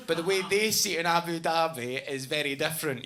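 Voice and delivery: ordinary delivery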